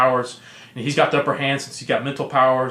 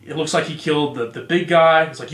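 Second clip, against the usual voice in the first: ordinary talk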